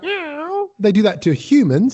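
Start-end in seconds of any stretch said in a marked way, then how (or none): 0.0-0.7 high, drawn-out cat meow; imitated cat meow. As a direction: mimic a cat's meow up high. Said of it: "when they go like".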